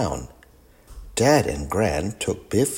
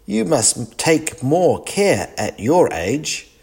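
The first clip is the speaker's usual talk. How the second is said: mildly cross; 7 semitones higher, slower than usual. Acted. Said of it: "Dad was cross".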